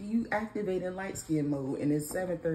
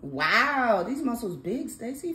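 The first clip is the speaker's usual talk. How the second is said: loud, drawn-out wow of admiration; 5 semitones higher, 6 dB louder, slower than usual. Real, not acted. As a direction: gush over big muscles. Gushing over child's muscles.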